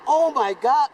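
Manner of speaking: loud, shocked exclamation; shocked gasp. Retold or imitated. A boy stunned at the near miss, loud shocked cry. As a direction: blurt it out in alarm, loud.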